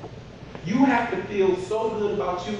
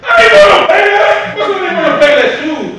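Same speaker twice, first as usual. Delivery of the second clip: loud and much higher, outraged; indignant refusal; 13 semitones higher, 16 dB louder than usual. Retold or imitated. Husband refusing his wife's suggestion, an indignant shout. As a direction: shout down the idea.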